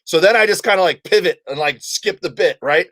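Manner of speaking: ordinary talk, nothing marked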